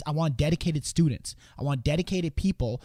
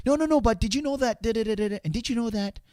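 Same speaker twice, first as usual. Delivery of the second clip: nervous overselling; 7 semitones higher than usual. Retold or imitated. Nervous salesman answering objections, high flustered. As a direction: scramble to save the sale. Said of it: "getting all, like, nervous".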